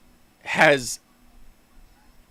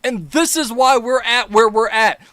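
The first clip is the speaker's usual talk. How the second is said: ordinary talk, nothing marked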